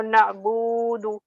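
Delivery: na'budu with a long, held bu; wrongly prolonged middle. Retold or imitated. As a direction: drag the bu of na'budu. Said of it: "No, it's wrong".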